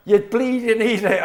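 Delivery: put-on botched Irish accent, high and loud; Alan Partridge. Guest attempting Irish for laughs, in a loud botched accent. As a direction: burst out in a hammed-up comic put-on voice. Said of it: "I sound like Alan Partridge".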